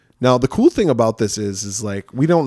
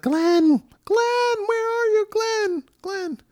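mock girl calling; much slower than usual. Girl in nightmare calls her boyfriend, high mock calling. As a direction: call in put-on falsetto, trailing away. Nancy calling for sleeping Glenn.

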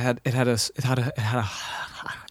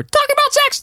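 Mickey Mouse voice; 6 dB louder than usual. Retold or imitated. Mickey voice in retelling.

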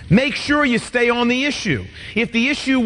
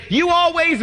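wife's accusation; 7 semitones higher than usual. Retold or imitated. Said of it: "Brenda will say".

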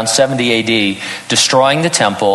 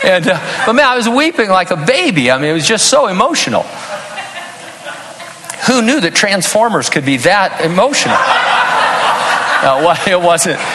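much higher, laughing through the words; 8 semitones higher than usual. Real, not acted. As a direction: chuckle at your own tears. Laughing at his own weeping.

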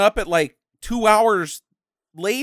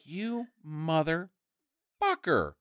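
mock muttered curse; 3 semitones lower, 9 dB quieter, much slower than usual. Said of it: "And they're like".

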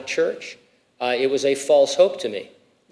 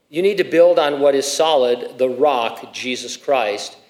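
ordinary talk, nothing marked

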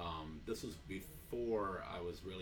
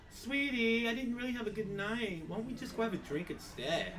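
high, sugary coaxing, laughing at the end; sweet stripper voice; 12 semitones higher, 4 dB louder than usual. Retold or imitated. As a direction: coo it like a stripper letting him down easy. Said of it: "they'd just be like".

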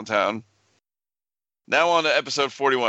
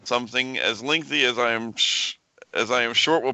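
usual speaking voice

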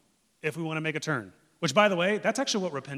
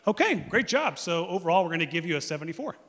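mock doctor grading; 5 semitones higher, 7 dB louder than usual. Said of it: "your doctor doesn't go".

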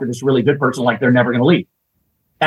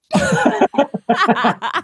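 high squealing laugh. Real, not acted. Laughs at punchline.

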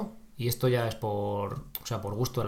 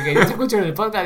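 eager stranger; 9 semitones higher, 8 dB louder, faster than usual. Retold or imitated.

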